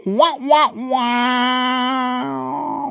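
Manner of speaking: long, high held wah; mock wah-wah noise. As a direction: draw out a high comic wah-wah. Mimics a mocking noise, held high.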